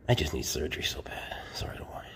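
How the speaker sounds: low pained whisper; weary whispered complaint; whining in pain. Real, not acted. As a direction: let the ache drain the voice.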